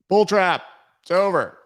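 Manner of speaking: high, loud cries of doom; mock panic. Streamer mocking traders who say Bitcoin died, mock panicked shout. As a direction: shout it like an overdramatic doomsayer. Mocks doomsayers, then says it's fine.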